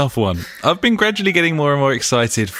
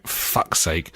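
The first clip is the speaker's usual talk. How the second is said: muttered; 6 semitones lower, 6 dB quieter than usual. Real, not acted. Muttered frustration at stumble.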